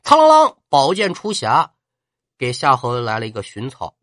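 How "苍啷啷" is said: ringing sword-drawing sound, loud and high; vocal clang of a drawn sword. Acted. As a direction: mimic a blade sliding out of its sheath.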